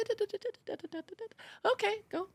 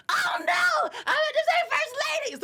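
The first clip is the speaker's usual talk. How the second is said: high, laughing, panicked correction; high embarrassed shout. Retold or imitated. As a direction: shriek the fix in panic. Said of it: "I'm like".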